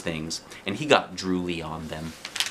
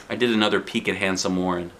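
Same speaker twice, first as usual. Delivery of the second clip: ordinary delivery, nothing marked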